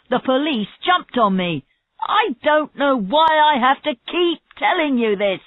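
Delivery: fed up with repeating his story; angry answer. A narrator's reading. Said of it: "He answered angrily".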